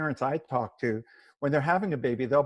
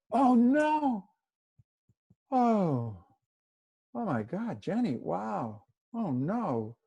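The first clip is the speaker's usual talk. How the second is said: heartfelt empathy; 7 semitones higher, much slower than usual. Shown as a demonstration. To an upset friend, wordless heartfelt sympathy. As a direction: sigh and exclaim as if you feel it too. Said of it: "If I don't say any words and I go".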